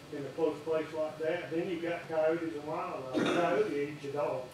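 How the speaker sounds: ordinary talk, nothing marked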